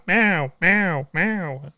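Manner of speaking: a lightly voiced mock cat meowing, fading off. The cat waking him up, mock meowing.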